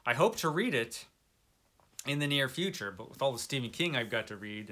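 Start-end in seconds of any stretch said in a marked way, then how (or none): none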